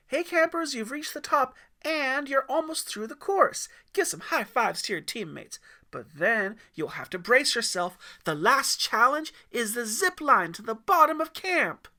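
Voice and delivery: high, loud, bright guide voice; rally the campers like an upbeat guide; cheerful camp guide. Acted. Guide announcing the zip line to the campers, chipper. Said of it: "One of the trained guides".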